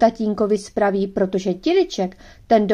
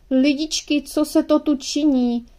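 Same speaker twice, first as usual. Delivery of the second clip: a higher-pitched grandmother's alarmed cry; 5 semitones higher than usual. A narrator's reading. Grandmother shocked at the mess, exclaiming. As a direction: throw up your hands in alarm. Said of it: "vykřikla babička".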